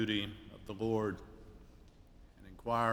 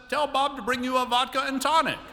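high, louder genteel voice, slowing at the end; imitating Gwen; 13 semitones higher, 6 dB louder than usual. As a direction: deliver the punchline in her high refined voice. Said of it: "I can't do her elegant accent".

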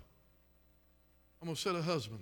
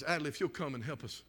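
normal talking voice

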